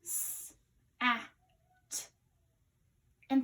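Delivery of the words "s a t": "S, A, T" sounded out one sound at a time; sounding out letters. Shown as a demonstration. Teacher sounding out sat for a class, segmented sounds. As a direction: segment "S, A, T" into separate sounds with pauses. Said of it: "Think about the sounds".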